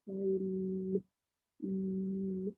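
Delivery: "Coin" with a long level n, then a held "Mm"; stretched sounds. Shown as a demonstration. Teacher isolating the next sound, "Coin" and "Mm" held. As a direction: hold the nasal at the end of "Coin". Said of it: "Can you hear the next sound?".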